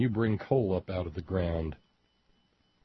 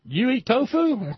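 high, louder mock voice of a union worker; union guy; 14 semitones higher, 5 dB louder than usual. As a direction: ham up a surprised working man. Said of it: "one of those wonderful, uh, union folks says".

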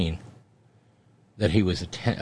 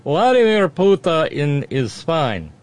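put-on slow, higher, louder cheesy Russian accent; 9 semitones higher, 7 dB louder, slower than usual. Host granting a listener's request, cheesy Russian accent. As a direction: drag it out like a Kremlin spokesman in a cheesy Russian accent. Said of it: "Emilio wanting more cheesy Russian accent".

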